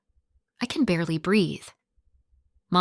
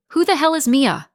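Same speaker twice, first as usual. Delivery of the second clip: high, loud cracking shout; 8 semitones higher, 6 dB louder than usual. A narrator's reading. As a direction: yell it as the voice breaks. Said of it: "I shout, my voice cracking".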